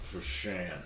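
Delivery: "Fushan" in two parts, poo then SHAN; pronunciation demo. Shown as a demonstration. Player telling Ralph how the monster's name goes, pronunciation demo. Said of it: "it is pronounced".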